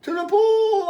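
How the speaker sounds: very high, loud cry; high-pitched urgent yell; boy's yell. A narrator's reading. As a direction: echo the call as an excited boy. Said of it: "I yelled".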